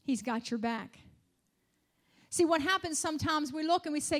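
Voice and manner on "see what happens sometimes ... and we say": fervent preaching. Acted. Preaching at full force.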